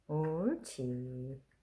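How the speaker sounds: long slow coaxing praise; drawn-out praise. Real, not acted. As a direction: stretch it out gently, praising the dog. Coaxing the dog to play.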